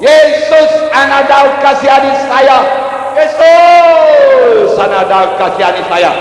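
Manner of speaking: high, loud shouted plea; Bartimeus. Acted. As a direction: shout the name long and high, begging to be heard. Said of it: "Bartimeus berseru". (berteriak memohon dengan suara tinggi)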